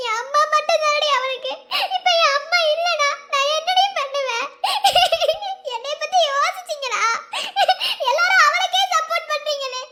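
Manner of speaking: high, strained, near-tearful protest; distressed outburst. Acted. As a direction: cry out in hurt and anger.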